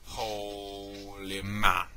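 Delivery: very slow, drawn-out exclamation; awed drawl. Real, not acted. Reviewer marvelling at a dream match, awed and drawn out. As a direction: stretch each word out long in awe.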